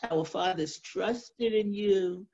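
normal talking voice

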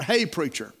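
ordinary delivery

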